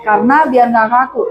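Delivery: ordinary talk, nothing marked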